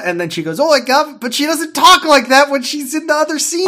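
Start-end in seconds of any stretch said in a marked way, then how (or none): 0.6-1.2 high, loud mock Cockney; exaggerated Cockney. Governess showing the children her secret voice, exaggerated Cockney. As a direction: put on a hammy Cockney accent. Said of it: "an exaggerated Cockney accent".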